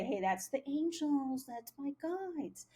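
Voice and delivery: airy, softening mimicry of spiritual folk; mock spiritual person. Mocking how spiritual people explain the voice to her. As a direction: parody the devout believer gently. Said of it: "like most spiritual people would say".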